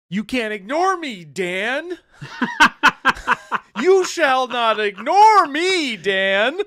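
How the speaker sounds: loud, high, slow hammed-up obsessive lover, laughing through it; obsessed stalker. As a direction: shriek it like Glenn Close in Fatal Attraction. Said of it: "Fatal Attraction kind of love".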